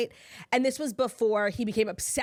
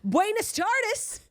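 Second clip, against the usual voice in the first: put-on Buenas tardes in a heavy American accent, high and slow; 10 semitones higher, much slower than usual. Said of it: "American accent you can think of".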